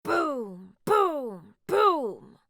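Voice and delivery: low booming footsteps; elephant footsteps. Acted. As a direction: stomp it out like a big elephant. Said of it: "the sound the elephant makes".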